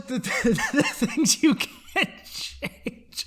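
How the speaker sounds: laughing through the words. Real, not acted. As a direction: recite it while breaking into laughter. Breaking up laughing mid-recital.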